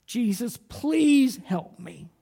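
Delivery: the blind woman's pleading call; calling out for help. Retold or imitated. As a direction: cry out slowly to Jesus. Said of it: "I called out".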